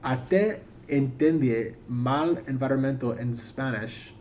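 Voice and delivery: slow, careful reading of Spanish words; reading foreign language. Shown as a demonstration. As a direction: sound out the Spanish slowly, word by word. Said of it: "I'm gonna try to read this in Spanish".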